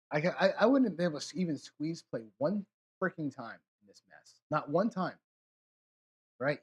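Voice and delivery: ordinary delivery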